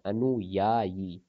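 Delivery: अनुयायी drawn out slowly, each syllable clear; slow spelled-out word. Shown as a demonstration. Teacher to class, slow careful spelling of अनुयायी. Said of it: "अनुयायी होता है यार".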